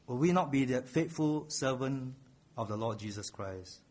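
ordinary talk, nothing marked

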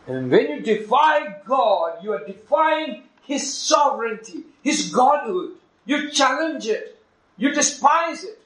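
high, loud climactic preaching; building sermon climax; fiery preaching. Real, not acted. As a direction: drive it home from the pulpit. Preaching at full force.

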